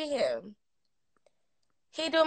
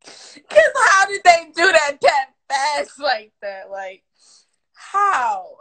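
amazed disbelief; 2 semitones higher, 6 dB louder, slower than usual. Real, not acted. Stunned by the fast magic trick.

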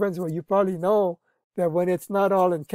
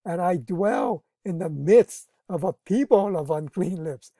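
ordinary talk, nothing marked

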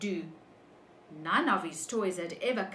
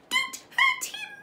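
very high, louder tiny mouse voice; little voice; 6 dB louder than usual. Acted. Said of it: "And a little voice said".

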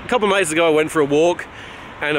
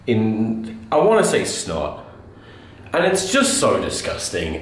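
usual speaking voice

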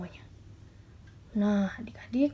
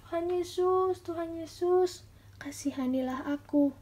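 crying out; 7 semitones higher than usual. Acted. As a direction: call out insistently, pitch raised, easing on the plea. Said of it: "kembali berteriak sekali lagi". (berseru memohon dengan nada tinggi)

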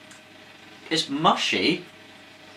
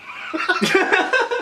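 high giggle. Real, not acted. Joking they will eat a horse, high laugh. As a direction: burst of high-pitched laughter. Joke about eating a horse.

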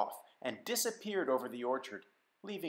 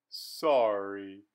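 lower, sluggish worm; a sleepy worm declining; 5 semitones lower than usual. Acted. The worm turning Simon down, low and sluggish. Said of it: "responded the worm".

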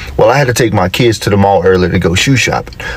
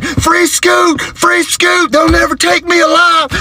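very high-pitched mock scream; imitated screaming; much slower than usual. Daughter running out the room, imitated high scream. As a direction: cry out like a child on the run. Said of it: "running out the room screaming".

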